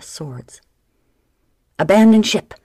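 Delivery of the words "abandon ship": loud, higher desperate shout; first mate ordering everyone overboard. Acted. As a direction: yell it as the ship burns. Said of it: "Ginger shouted".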